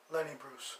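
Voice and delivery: normal talking voice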